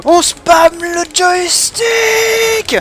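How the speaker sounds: very high, loud, drawn-out yells; frantic shouting. Real, not acted. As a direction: yell while mashing buttons. Mashing the stick in a struggle.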